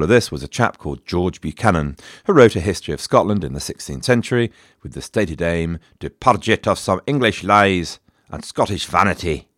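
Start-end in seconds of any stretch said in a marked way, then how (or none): 6.0-9.5 put-on light Scottish accent for the quote. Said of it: "he said it in a Scottish accent".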